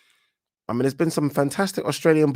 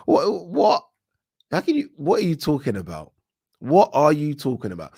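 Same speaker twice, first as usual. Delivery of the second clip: high-pitched, baffled disbelief; incredulous; 5 semitones higher than usual. Acted. Host challenging the fighter's claim to have won, incredulous. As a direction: demand an explanation, pitch jumping up.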